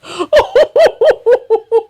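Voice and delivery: loud, high-pitched burst of laughter; real derisive cackle; high hooting laugh. Real, not acted. Cracks up at played clip.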